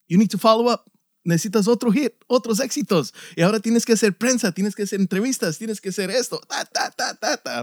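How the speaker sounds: high, rapid-fire list of demands; demanding record label. Acted. Label pressures artist after first album, high hurried demands. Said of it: "Y después llega la disquera, "Ok".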